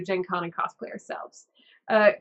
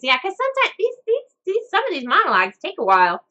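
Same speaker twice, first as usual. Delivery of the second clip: puppet voice; 10 semitones higher, 6 dB louder than usual. Acted. Puppet Sprinkles speaks.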